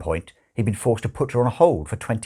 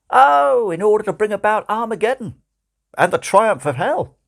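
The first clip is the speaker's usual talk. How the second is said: a demon's offhand answer, higher and louder; Crowley voice; 12 semitones higher, 6 dB louder than usual. Acted. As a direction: toss it off high, loud and quick.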